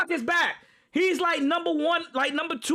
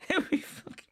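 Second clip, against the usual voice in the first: laughing; 2 semitones higher, 5 dB quieter than usual. Real, not acted. Laughing mid-sentence.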